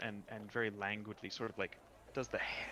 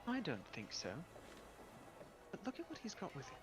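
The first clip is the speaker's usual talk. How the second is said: curious water nymph; 6 semitones higher, 7 dB quieter than usual. Acted. GM voices pool woman.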